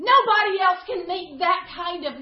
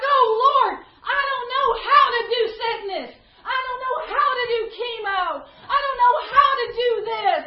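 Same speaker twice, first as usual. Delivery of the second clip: louder, higher desperate plea to God; crying out to the Lord; 6 semitones higher, 6 dB louder than usual. Pretend. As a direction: cry out to God, overwhelmed by illness. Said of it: "you're crying out to the Lord".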